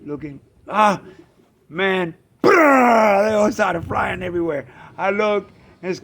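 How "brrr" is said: loud rolled "brrr"; imitated wing flutter. Birds burst out of the lanai door, imitated flutter. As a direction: burst of flapping wings.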